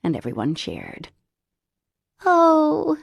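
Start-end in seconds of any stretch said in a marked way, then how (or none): 2.2-3.0 high, loud, slow gleeful crow. A narrator's reading. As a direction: crow it out teasingly. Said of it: "the Holy Rollers crowed".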